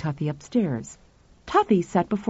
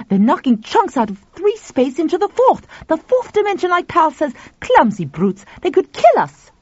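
high, agitated outburst; urgent, agitated alarm; alarmed realization; 9 semitones higher, 4 dB louder than usual. A narrator's reading. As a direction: blurt out the danger, voice raised.

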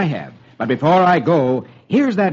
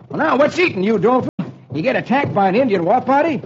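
higher, puzzled cowboy questioning; cowboy hero Roy; 8 semitones higher, faster than usual. Acted. Roy questioning his frightened friend, puzzled cowboy hero. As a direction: ask a rattled friend what is wrong.

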